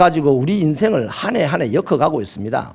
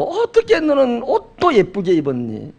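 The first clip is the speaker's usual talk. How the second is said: kind compliment; 7 semitones higher, slower than usual. Retold or imitated. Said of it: "빈말이라도".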